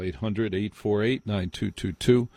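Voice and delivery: normal talking voice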